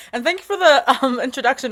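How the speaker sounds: flustered laugh breaking into the thanks. Real, not acted. Guest thanks hosts for the introduction, flustered. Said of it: "I always get so flustered".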